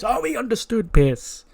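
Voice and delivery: smug, sarcastic reply to a threat; mocking sneer. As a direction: Seth sneering back at Pearce, high then loud. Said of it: "And he goes".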